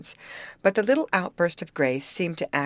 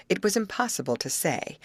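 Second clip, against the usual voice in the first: ordinary delivery, nothing marked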